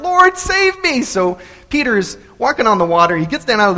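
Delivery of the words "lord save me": high, loud, drawn-out plea; crying out. Acted. Sinking Peter calls to Jesus, crying out for help. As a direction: cry out for rescue. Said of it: "cried out".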